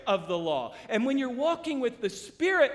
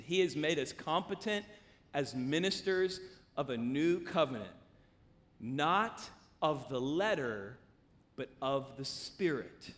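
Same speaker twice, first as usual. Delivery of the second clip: solemn scripture reading; 6 semitones lower, 4 dB quieter than usual. Real, not acted. Preacher reading Scripture to the congregation, solemn. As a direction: read it reverently, letting each phrase land.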